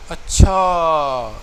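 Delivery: اچھا drawn out slowly and loud, amazed; a long, loud, surprised اچھا; the surprised "oh really" اچھا. Shown as a demonstration. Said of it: "یعنی یہ surprising اچھا ہے".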